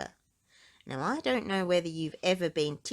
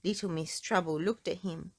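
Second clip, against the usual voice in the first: ordinary delivery, nothing marked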